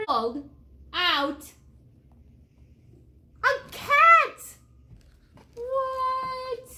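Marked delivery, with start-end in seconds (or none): 3.4-4.6 high, loud shout of surprise; astonished reveal. A narrator's reading. Storyteller reveals what doctor pulled out, astonished shout. Cat revealed inside the puppy. 5.5-6.7 high, long-held what; astonished. Acted. Storyteller reacts to a cat pulled from George, high astonishment.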